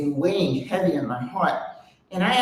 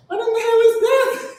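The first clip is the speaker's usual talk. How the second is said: high, laughing shocked outburst; incredulous squeal of disbelief; 4 dB louder than usual. Retold or imitated. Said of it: "And I'm like".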